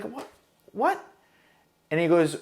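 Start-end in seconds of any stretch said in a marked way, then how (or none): 0.0-1.0 sharp high confused what; baffled what. Retold or imitated. Startled recruit asking what he means. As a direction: caught off guard, asking twice. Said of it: "I was like".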